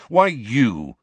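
loud, high, hostile jab; aggressive. Acted. Sirius challenging Snape, loud and aggressive. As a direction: fling it at Snape like an accusation. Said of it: "asked Sirius aggressively".

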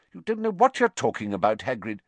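high, sharp rebuke dropping on the name; official's sharp reprimand. A narrator's reading. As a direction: cut him off sharply. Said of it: "said Fudge sharply".

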